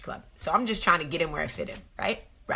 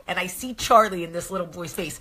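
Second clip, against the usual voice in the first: normal talking voice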